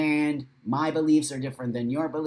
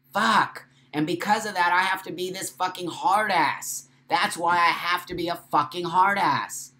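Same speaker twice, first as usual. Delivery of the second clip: higher and louder, venting hard; frustrated outburst; 5 semitones higher, 4 dB louder than usual. Real, not acted. Venting at her community, loud frustrated outburst. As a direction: spit out the frustration. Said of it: "I don't wanna say angry".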